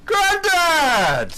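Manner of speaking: sudden loud shout. Real, not acted. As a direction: yell it out suddenly.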